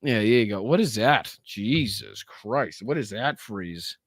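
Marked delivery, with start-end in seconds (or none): none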